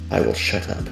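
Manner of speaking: ordinary talk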